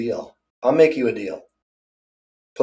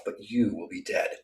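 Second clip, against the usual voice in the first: quiet menace; 4 semitones lower, 12 dB quieter than usual. Real, not acted. Threatening his rival, softly and coldly. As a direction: voice sinks much softer.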